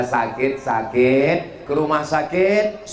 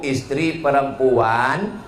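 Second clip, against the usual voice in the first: normal talking voice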